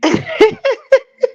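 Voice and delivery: loud, high staccato cackle; cackling laugh. Real, not acted. Host tickled by the rival's admission, cackling laugh. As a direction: cackle helplessly in quick bursts. Cracking up at own impression.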